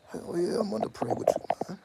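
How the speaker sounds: high, loud enthusiastic yes; eager yes. Retold or imitated. Eager answer to son.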